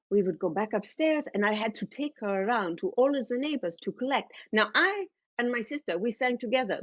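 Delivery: put-on German-accented mother telling a childhood tale; German mother reminiscing. Mother recalling her little sister's sugar, German-accented voice.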